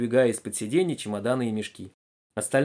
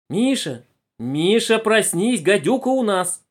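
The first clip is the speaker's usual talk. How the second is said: raised, high alarmed call; urgent waking call; 8 semitones higher, 5 dB louder, slower than usual. Acted. Passenger wakes Misha about the viper, calling urgently. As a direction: rouse a sleeper with an urgent warning.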